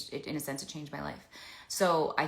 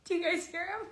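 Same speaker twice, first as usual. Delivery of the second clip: delighted high voice. Real, not acted. Dog making noise.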